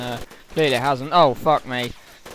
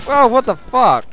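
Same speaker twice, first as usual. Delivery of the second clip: loud, high startled swear; shocked outburst; 8 semitones higher, 7 dB louder than usual. Real, not acted. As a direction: blurt it out in sudden shock. Sudden shock mid-game.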